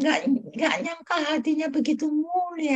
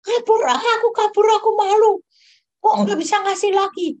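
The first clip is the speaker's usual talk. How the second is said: retold panic; 5 semitones higher, 5 dB louder than usual. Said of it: "Aku bilang".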